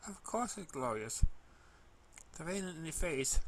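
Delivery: usual speaking voice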